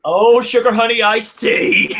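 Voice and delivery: loud and high through laughter; laughing exclamation. Real, not acted. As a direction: blurt it out laughing.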